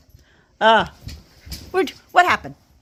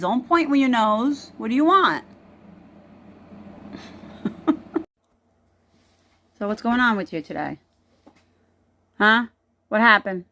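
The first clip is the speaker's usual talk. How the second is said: ordinary talk, nothing marked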